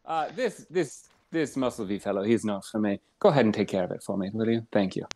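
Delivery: low quick murmur to a servant; aside to the help. Acted. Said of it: "No, I'm still Geraldine".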